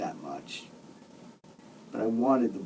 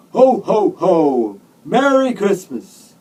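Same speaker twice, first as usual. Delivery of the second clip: big, booming Santa shout, high and loud; ho-ho-hoing Santa; 8 semitones higher, 12 dB louder, slower than usual. A narrator's reading. Santa comes into the hospital room, ho-ho-hoing loudly. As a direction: belt out the jolly greeting, stretching it slowly. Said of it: "Friggin' Santa came ho-ho-hoing".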